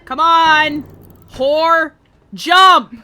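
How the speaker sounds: loud high jeering shouts; yelling taunts. Real, not acted. Yelling at Sly Fox in Minecraft to jump. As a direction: bellow at him to jump.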